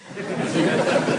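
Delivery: quick high breathy chuckle; amused chuckle after the Japan joke; real laugh. Real, not acted. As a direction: chuckle at your own jab.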